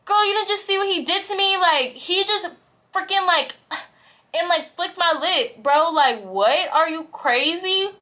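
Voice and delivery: high-pitched, worked-up indignation; outraged protest. Retold or imitated. Telling best friend he flicked my lip, outraged. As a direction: defend yourself in scandalized outrage.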